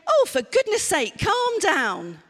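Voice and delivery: loud, high exasperated outburst; impatient telling off of an angry child; exasperated snap. Acted. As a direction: lose patience and tell them off. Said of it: "and I say".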